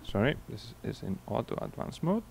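ordinary talk

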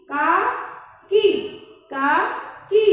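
काकी stretched slowly, then काकी said briskly; slow then normal. Shown as a demonstration. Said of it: "इसके हम शब्द भी बता देते हैं".

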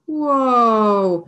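loud, drawn-out Whoa; loud theatrical whoa; amazed whoa. Shown as a demonstration. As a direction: wow them with mock amazement. Teacher marvels at result.

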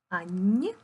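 usual speaking voice

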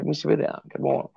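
muttered under his breath; quiet aside. Real, not acted. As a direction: say it softly to yourself. Repeats the cam check under his breath.

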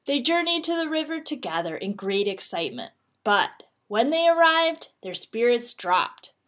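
ordinary delivery, nothing marked

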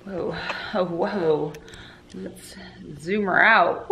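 ordinary delivery

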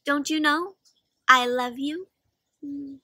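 ordinary talk, nothing marked

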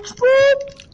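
silly high-pitched burst; high squeal. Pretend. Squeals a cartoon name.

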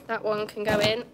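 normal talking voice

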